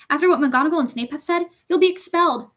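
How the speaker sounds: alarmed protest. A narrator's reading. Girl warns friend of expulsion, alarmed. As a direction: warn him urgently, rising in alarm.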